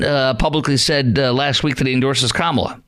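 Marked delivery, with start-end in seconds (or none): none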